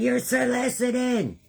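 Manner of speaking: loud high yell at the scammer; calling out a scammer, loud and high; retold yelling. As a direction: shout him off the porch. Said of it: "I'm yelling".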